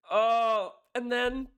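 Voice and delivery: high, loud burst of excitement; excited gasp. Real, not acted. Reacting to the song, a loud excited oh. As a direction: caught off guard by the lyric. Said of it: "goosebumps reaction".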